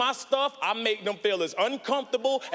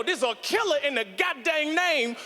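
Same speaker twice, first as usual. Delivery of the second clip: usual speaking voice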